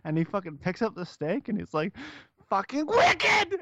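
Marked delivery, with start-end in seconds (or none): 2.5-3.6 high, slow furious scream; drawn-out enraged yell; screamed quote. Retold or imitated. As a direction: scream it out like you snapped. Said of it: "that hard scream".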